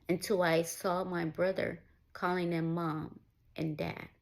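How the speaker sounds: ordinary talk, nothing marked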